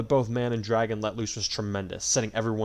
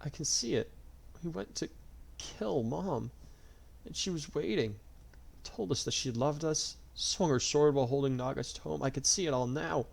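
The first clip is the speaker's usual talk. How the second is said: tearful squeak; 5 semitones higher, 5 dB quieter than usual. A narrator's reading. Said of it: "Morgan squeaked out".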